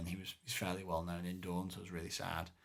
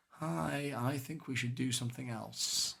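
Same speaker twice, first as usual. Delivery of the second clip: small, higher-pitched meek suggestion; humble yeoman; 5 semitones higher, slower than usual. Retold or imitated. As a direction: pipe up timidly as a humble commoner. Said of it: "a humble yo folk".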